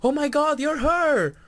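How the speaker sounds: high, loud astonished squeal; acted amazement. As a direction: imitate a stunned friend's excited realization. Acting his amazed realization.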